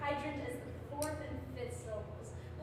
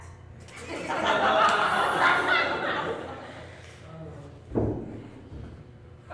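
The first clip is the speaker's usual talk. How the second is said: long loud laugh; 8 semitones lower, 12 dB louder than usual. Real, not acted. Laughs at the rat guess.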